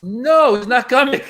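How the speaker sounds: high-pitched imitation of a hapless staffer; staffer breaking news. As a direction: deliver bad news high, as the messenger. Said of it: "He goes".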